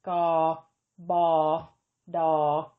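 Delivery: gaw, baw, daw said one at a time as Thai stop consonants; gaw, baw, daw as separate pronunciation examples; Thai stop sounds. Shown as a demonstration. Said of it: "one of the following consonant sounds".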